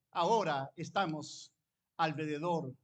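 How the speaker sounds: ordinary talk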